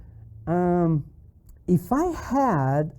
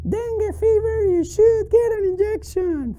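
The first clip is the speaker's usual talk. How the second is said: very high squeaky puppet voice; piping little character voice; Charlie; 12 semitones higher than usual. Acted. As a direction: recite the answer as a squeaky puppet. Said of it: "Very good, Charlie".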